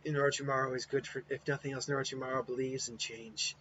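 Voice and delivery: soft and weary, fading out; frail Kimimaro. Acted. Sickly Kimimaro greeting Naruto, quiet and weary. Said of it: "And it was like".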